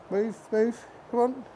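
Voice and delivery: quiet coaxing to the mare; soft coaxing. Real, not acted. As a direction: softly urge the horse on, half under breath. Coaxing the horse in the yard.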